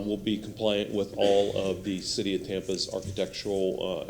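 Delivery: ordinary delivery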